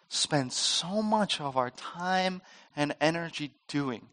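usual speaking voice

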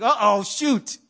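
sudden mild alarm; dismayed. Retold or imitated. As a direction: realize you're in trouble. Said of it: "you're like".

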